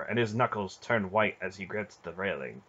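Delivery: ordinary delivery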